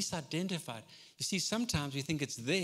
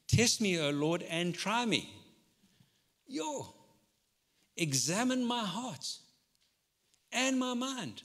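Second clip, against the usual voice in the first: fervent psalm prayer; 5 semitones higher, slower than usual. Acted. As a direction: lift the verse up slowly. Prays the psalm with force.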